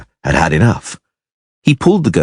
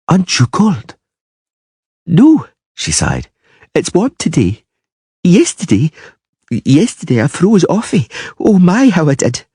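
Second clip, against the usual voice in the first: put-on high, chatty young girl; a child talking in the dark; little girl voice; 11 semitones higher than usual. A narrator's reading. Ciri chatting.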